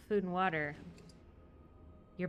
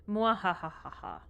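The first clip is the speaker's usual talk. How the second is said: evil villain laugh; 3 dB louder, slower than usual. Acted.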